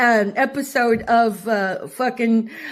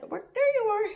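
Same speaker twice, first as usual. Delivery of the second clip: high, soft pet-talk voice; talking to a dog; 9 semitones higher, 7 dB quieter, faster than usual. Real, not acted. Woman greeting the puppy in a high, soft baby voice. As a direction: coax the puppy sweetly, high and hushed.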